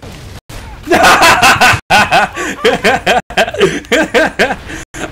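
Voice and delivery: high, loud burst of laughter. Real, not acted. Gamer laughing at getting caught out, loud laugh. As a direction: crack up loudly. Laughing after being killed.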